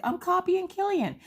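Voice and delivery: very high-pitched child impression; little kid voice. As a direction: squeak it like a proud small child. Voicing young daughter's answer.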